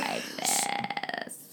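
ghostly breathy whisper; spooky voice. Shown as a demonstration. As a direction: hiss it like a demon in the dark. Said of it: "A spooky voice".

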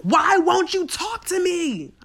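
high, loud, slow cry of frustration at God; furious plea to God. Retold or imitated.

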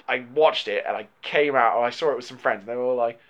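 normal talking voice

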